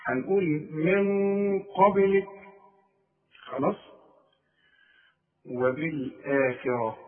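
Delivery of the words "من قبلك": من قبلك with the نون pushed through the nose, slowed; nasal ghunna. Shown as a demonstration. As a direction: let the nasal ring on من قبلك. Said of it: "الغنة هتخرج من الخيشوم". (غنة من الخيشوم في من قبلك)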